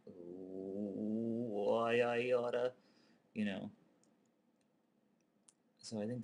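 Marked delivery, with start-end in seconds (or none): 0.1-2.7 building anger. Retold or imitated. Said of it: "his anger builds".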